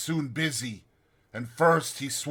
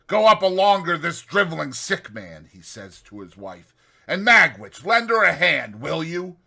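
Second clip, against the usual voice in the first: hearty loud command; 4 semitones higher, 6 dB louder than usual. A narrator's reading. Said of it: "Compeyson spoke hearty".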